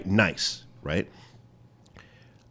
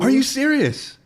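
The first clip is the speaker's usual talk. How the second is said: high, loud, incredulous "are you serious"; can't believe she forgot; shocked disbelief; 7 dB louder than usual. Real, not acted. Host to guest who forgot his advice, shocked and high.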